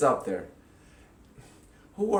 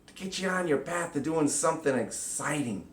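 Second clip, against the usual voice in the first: normal talking voice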